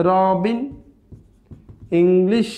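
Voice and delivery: Robin and English in two loud, separated beats; split in two syllables. Shown as a demonstration. Teacher showing two-syllable words, loudly split in two. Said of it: "আমরা দুইটা দুইটা বলছি".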